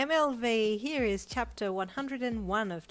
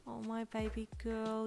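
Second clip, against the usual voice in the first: wobbly voice; 4 semitones higher than usual. Acted. Mum seeing her daughter in the gown, wobbly voice. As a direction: hold back tears as you say it. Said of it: "your mum said in a wobbly voice".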